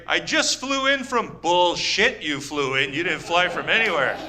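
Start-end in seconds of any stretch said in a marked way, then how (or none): 0.1-1.4 put-on old comic's line. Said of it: "You can't, like".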